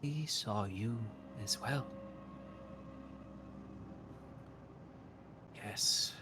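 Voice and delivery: lightly voiced mystic, slow and hushed in places; a cryptic oracle studying a stranger; a hint of an otherworldly prophetess; eerie seer woman.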